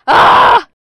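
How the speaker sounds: piercingly high, very loud scream; reenacted scream. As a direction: scream as if gone mad. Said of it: "volvía a gritar".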